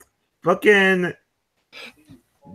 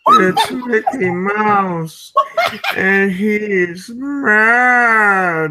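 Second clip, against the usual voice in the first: mock dread; 5 semitones higher, much slower than usual. Acted Quesada reacting in fear.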